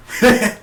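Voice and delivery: short high mock cackle; imitated villain laugh. Reviewer showing villain's laugh, brief imitated burst. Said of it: "he was just like".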